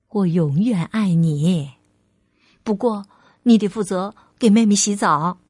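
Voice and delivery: low, tender motherly voice; loving mother, reassuring. Acted. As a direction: reassure your little one sincerely.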